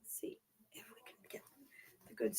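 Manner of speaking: soft unvoiced whisper; a mother whispering from the side of the room. Real, not acted. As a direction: whisper it so the mic barely catches you. Mom whispering while adjusting camera.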